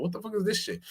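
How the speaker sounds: incredulous. Retold or imitated. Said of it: "I'm like".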